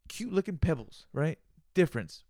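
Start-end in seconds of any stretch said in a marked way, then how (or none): 0.1-1.1 cute mock voice. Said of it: "I'm filling it with".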